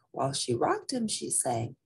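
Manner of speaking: usual speaking voice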